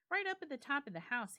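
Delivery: lightly voiced host showing a guest up; host John's voice. A narrator's reading. Narrator voicing John showing the guest his room, brisk. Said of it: "he said".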